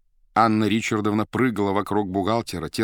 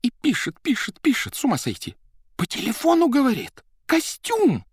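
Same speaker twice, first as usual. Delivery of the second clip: high, loud babbling after sobs; rambling hysteria; 3 dB louder than usual. A narrator's reading. Secretary to the accountant, high hysterical babble. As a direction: gabble on, half out of her mind. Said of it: "понесла что-то уж совсем несообразное".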